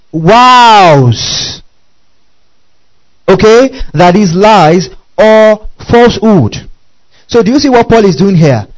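loud, high preaching climax; full-force sermon cry. Real, not acted. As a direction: preach it out with fire.

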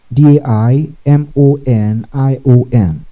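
ordinary delivery

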